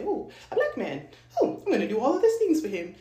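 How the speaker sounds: high, loud, hammed-up swooning; put-on thrilled squeal, rushing at the end; mock gushing. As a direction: gush with fake over-eager excitement. Mocks an over-eager woman.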